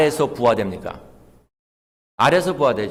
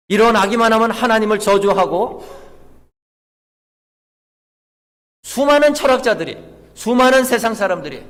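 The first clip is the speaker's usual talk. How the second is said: high, loud, insistent pulpit delivery; forceful preaching; 7 semitones higher, 6 dB louder than usual. Real, not acted. Preacher telling congregation of man cursing God, high and forceful. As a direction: drive each phrase home at raised pitch and volume.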